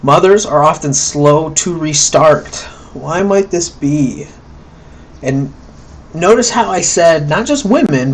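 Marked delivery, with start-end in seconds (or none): none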